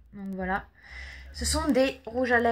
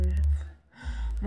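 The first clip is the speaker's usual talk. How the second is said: usual speaking voice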